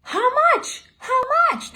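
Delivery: high, loud imitation of eager children; excited kids. Two kids asking au pair about jackpot, excited squeal. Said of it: "both kids, Jocelyn and Garrett, were like".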